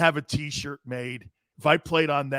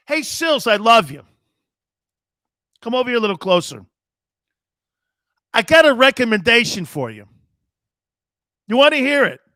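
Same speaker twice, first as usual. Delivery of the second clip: louder, higher mob-boss menace; mock mob boss; 4 semitones higher, 8 dB louder than usual. Host plays a mob boss leaning on his co-host Sills, louder. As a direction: lean in like a boss making an offer.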